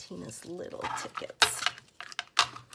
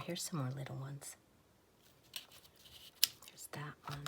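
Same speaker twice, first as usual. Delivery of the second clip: hushed, breathy ASMR murmur; soft whisper; 2 semitones lower, 7 dB quieter than usual. Acted. Crafter whispering to viewers while sorting stamps, soft whisper. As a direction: whisper it close to the mic.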